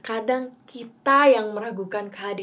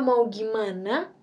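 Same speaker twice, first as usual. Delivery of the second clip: ordinary talk, nothing marked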